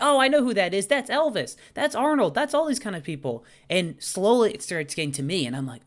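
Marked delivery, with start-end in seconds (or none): 0.0-3.5 mock classmates. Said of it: "They're like".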